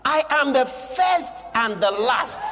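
loud, high shouted proclamation; preaching at full force. Acted. Preacher shouting Jesus' words to John, loud and high. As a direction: thunder it out to the church.

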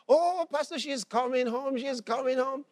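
high, elated retold insistence; excited. Mother assuring pastor her daughter returns, excited. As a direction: imitate a thrilled mother, pitch held high. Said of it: "She's so excited".

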